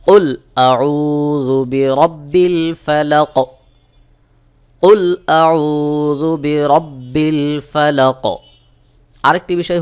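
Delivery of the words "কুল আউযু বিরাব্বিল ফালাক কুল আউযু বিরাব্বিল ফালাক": slow, drawn-out tajweed chanting; slow Quran recitation. Shown as a demonstration. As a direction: recite slowly with qalqalah on each letter. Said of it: "সহি উচ্চারণ হবে". (ধীরে তাজবীদসহ তিলাওয়াত)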